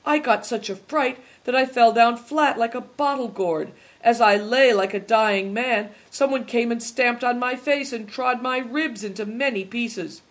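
high, aggrieved lament; plaintive. A narrator's reading. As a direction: pour out a woeful complaint. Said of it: "asked Hailstone plaintively".

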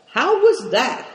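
sharp, high impatient question. A narrator's reading. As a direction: demand it at once, no patience left. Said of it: "asked Dampignac impatiently".

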